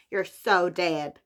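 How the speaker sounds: slow, drawn-out American accent. Shown as a demonstration. Repeating the book title for fun, in an American accent. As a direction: put on an American accent, stretching it out. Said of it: "say it in that American accent".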